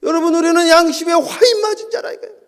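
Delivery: loud, high proclamation of 화인; loud preaching. Acted. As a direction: cry out 화인 loud and high to the congregation. Preacher hammers the verse.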